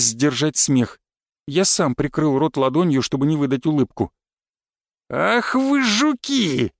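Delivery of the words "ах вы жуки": high, slow indignant shout; крик. A narrator's reading. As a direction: yell it out in mock outrage. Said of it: "с криками понёсся". (возмущённый крик)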